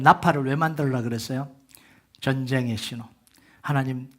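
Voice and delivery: ordinary talk, nothing marked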